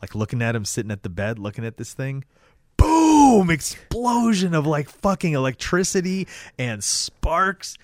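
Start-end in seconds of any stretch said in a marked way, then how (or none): 2.8-7.8 high, loud boom, then slow and drawn out; explode, then stretch out the spectacle; dramatic excited outburst. Retold or imitated. Dramatic climax of scene retold.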